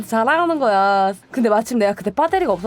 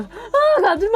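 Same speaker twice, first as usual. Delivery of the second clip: mock plea; 9 semitones higher, much slower than usual. Begging friends not to leave.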